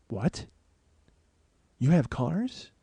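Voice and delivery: quiet, halting double take; stunned disbelief. Retold or imitated. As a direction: let it sink in, barely voicing the question. Stunned reaction to the driver's answer.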